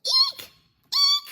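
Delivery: very high squeaky eek; high squeal. Acted. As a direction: squeal like a startled mouse. Larry squeaks after polka dots.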